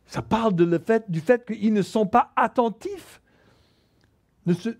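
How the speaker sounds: ordinary talk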